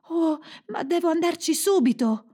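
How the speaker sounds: put-on high, urgent girl's exclamation; excited high-pitched cry; exclaiming. A narrator's reading. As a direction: burst out eagerly in a high voice. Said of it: "esclamò Gerda".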